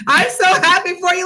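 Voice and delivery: high, gushing fake congratulations; feigned delight. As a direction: overdo being happy for them. Feigned congratulations to delusional colleague.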